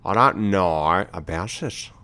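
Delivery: slow mock Australian drawl; Australian accent. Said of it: "doing Australian accent".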